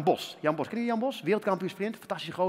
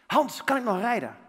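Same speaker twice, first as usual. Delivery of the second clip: urgent question; 4 semitones higher, 4 dB louder than usual. Retold or imitated. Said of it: "En ik had één vraag".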